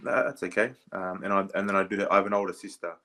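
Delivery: ordinary delivery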